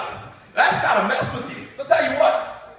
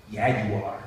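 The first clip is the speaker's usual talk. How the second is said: low, smug put-down; smug celebrity voice; smug Bobby; 11 semitones lower, 5 dB quieter than usual. Retold or imitated. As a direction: drop the voice, smug and cocky. Bobby Flay smug reply.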